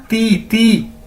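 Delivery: ती with a soft dental t, not टी. Shown as a demonstration. Teacher drills Chinese di for students, soft dental ती. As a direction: say ती ती lightly with the tongue on the teeth. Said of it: "टी नहीं इसको कहना".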